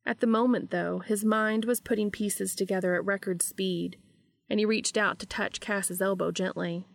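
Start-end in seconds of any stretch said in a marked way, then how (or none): none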